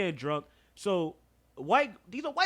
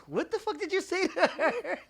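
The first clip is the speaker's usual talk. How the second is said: retold angry cousin; 10 semitones higher, 3 dB louder than usual. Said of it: "he goes".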